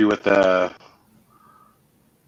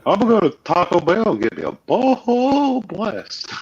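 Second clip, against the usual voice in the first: high-pitched, slow cartoon Texan voice; King of the Hill imitation; 12 semitones higher than usual. Imitating a King of the Hill character for laughs, high and slow. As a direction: ham up a cartoon Texan ordering fast food. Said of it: "You do an awesome imitation".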